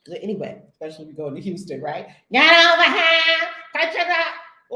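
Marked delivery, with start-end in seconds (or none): 2.3-4.7 loud barked order, slow and heavy; imitating a TSA yell. TSA agent barking at travelers, loud mock yell. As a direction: yell it like an airport guard. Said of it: "Let's hear you bark at people".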